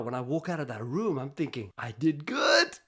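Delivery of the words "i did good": slow, rising much higher on better; proud relief. Retold or imitated. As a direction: savor the small win slowly. Proud inner thought after talk.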